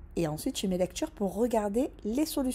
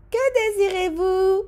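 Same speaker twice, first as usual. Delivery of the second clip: high, bright server's greeting; a high, loud counter greeting; waitress voice; 12 semitones higher, 10 dB louder than usual. Acted. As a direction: chirp it like a waitress.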